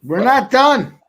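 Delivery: loud, high defiant yell; defiant shout. Real, not acted. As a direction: shout it out like a diehard fan. Defiant fan cheering for the Oilers.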